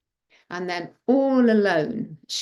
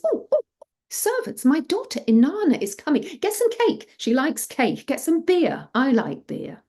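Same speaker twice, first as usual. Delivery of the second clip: high, flustered calls to servants; excited father; 9 semitones higher, 3 dB louder than usual. A narrator's reading. Father to servants as his daughter arrives, excited. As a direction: fuss and give orders in high-pitched delight.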